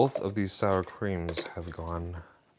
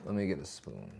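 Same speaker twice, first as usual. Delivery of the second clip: distant, calling from another room; 4 semitones lower, 8 dB quieter than usual. Real, not acted. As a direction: far off in the kitchen. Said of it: "you can't see me 'cause I'm in the kitchen".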